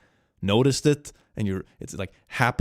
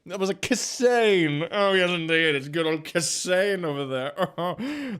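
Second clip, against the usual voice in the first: higher, slow, hammed-up announcer; mock grand voice; 7 semitones higher, slower than usual. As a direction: savor the name like a showman. Jokey voice for demon name.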